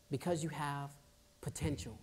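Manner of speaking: soft, low, tender words; gentle reply. Retold or imitated. Vice principal kneeling to a troubled boy, tender. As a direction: say it softly at eye level.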